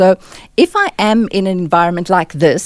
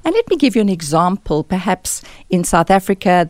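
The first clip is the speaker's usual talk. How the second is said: ordinary talk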